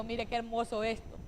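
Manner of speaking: ordinary talk, nothing marked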